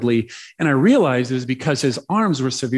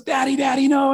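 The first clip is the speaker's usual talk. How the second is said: put-on high-pitched child's protest; imitated little boy; 10 semitones higher than usual. Boy about to be thrown, imitated high protest. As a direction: squeal it like a playful kid.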